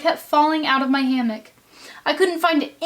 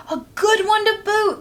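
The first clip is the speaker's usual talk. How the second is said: higher pirate crew echoing in agreement; crew chorus voice; 10 semitones higher than usual. Acted. Pirate crew agreeing about the digger, chorus voice. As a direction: chime in as the rowdy crew. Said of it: "the others agreed".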